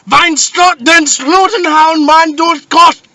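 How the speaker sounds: high, loud, slow barked fake German; aggressive mock German. Shown as a demonstration. Contrasting with polite English, barking loud fake German. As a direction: bark fake German like a drill sergeant.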